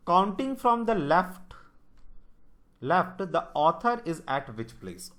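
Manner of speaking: reading the English line out word by word, slowly; slow question read. Shown as a demonstration. Teacher slowly reads the puzzle statement to students, deliberate.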